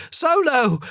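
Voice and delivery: high, loud cry of the name. A narrator's reading. Mum cries out as Solo pulls free, loud. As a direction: call the dog back in alarm. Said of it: "she cried".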